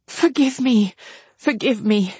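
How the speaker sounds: high plea falling away; remorseful sister. A narrator's reading. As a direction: beg forgiveness of your sister. Marianne begs forgiveness.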